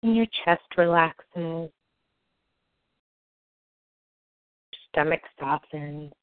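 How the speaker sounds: slow, soft soothing meditation voice; guided meditation. Acted. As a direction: ease them gently into calm.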